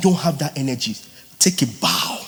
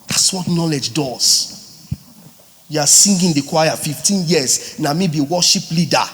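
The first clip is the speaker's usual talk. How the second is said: ordinary delivery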